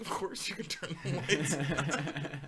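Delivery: slow, laughing, matter-of-fact reply; amused slow retort; laughing quote. Retold or imitated. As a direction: chuckle while stating the obvious. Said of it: "And he's like".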